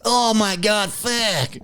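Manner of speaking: put-on high, pained old man's groan; old guy swearing. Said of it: "this old guy go".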